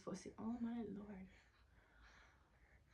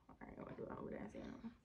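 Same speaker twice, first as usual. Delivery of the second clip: whispered aside; 8 dB quieter, faster than usual. Real, not acted. Muttering aside to herself.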